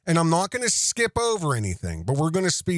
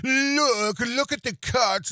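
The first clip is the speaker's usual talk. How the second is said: mocking skeptic; 7 semitones higher, slower than usual. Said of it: "I don't want any aspersions that".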